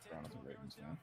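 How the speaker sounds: very low, soft aside; low-pitched, hard-to-catch remark; low mumble. Real, not acted. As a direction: slip it in under your breath. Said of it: "said what?".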